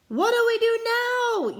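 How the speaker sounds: high, loud, slow cry; panicked yell. Acted. Pirate panics in storm, loud yell. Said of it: "yelled one of the pirates".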